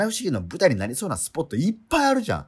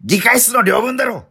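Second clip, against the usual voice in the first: exasperated shout; 8 semitones higher, 6 dB louder than usual. Real, not acted. Exasperated comeback.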